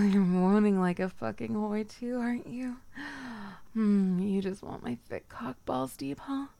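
ordinary talk, nothing marked

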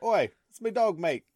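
high, loud mock Aussie; mock Australian shout. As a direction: shout it like an angry Aussie bloke. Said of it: "was like".

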